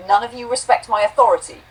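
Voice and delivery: high, loud outburst of a tiny robot; sudden yell. A narrator's reading. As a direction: lose composure and shout it. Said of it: "he's suddenly yelling".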